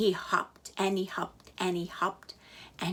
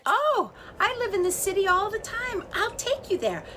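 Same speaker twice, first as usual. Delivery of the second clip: high, bright-voiced offer; the wind eager to help; cheerful wind; 12 semitones higher, 4 dB louder than usual. A narrator's reading. Wind offering the chick a ride, high.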